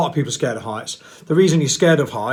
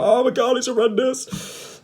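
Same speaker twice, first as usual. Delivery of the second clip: higher, slow drawn-out wail of horror; mock dramatic panic; 10 semitones higher, slower than usual. Scared people overreacting, hammed-up high wail. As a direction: ham up the horror. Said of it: "other people are like".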